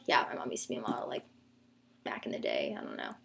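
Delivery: fast whispered aside; whispering. Real, not acted. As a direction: rush it out in a whisper.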